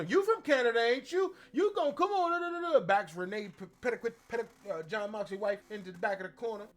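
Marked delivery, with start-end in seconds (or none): 0.0-2.8 high, loud taunting challenge; imitating Jade Cargill. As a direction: corner her with a mocking dare. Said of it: "tells her".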